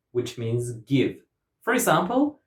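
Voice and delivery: normal talking voice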